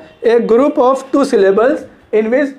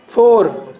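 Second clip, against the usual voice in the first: "फोर" drawn out as the stressed syllable; stretched फोर; much slower than usual. Shown as a demonstration. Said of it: "और इसको खींचा".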